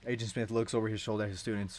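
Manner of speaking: ordinary delivery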